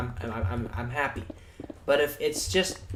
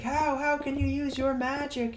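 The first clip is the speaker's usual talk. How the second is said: much higher, stammering disbelief; mock shocked villain. The bad guy asking the hero how, shocked. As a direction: play the stunned bad guy. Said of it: "and the bad guy's like".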